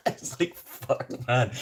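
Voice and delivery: laughing. Real, not acted.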